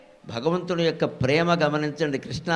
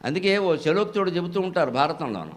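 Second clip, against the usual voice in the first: normal talking voice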